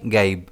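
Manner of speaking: "गाइब" said as one syllable, falling in pitch; pronunciation demo. Shown as a demonstration. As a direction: model the word "गाइब" on a low, falling note. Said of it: "इसे हम pronounce कैसे करेंगे?". ("गाइब" का उच्चारण, गिरते सुर में)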